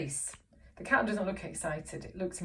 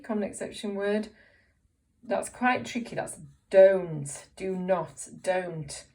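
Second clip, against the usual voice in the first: ordinary talk, nothing marked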